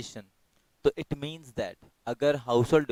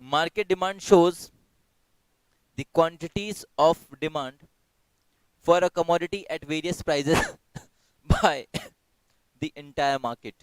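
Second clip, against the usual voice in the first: slow, deliberate, louder read of the question; slow quiz reading; 3 semitones higher, 3 dB louder, much slower than usual. Acted. Teacher reads quiz question to online class, slow and deliberate.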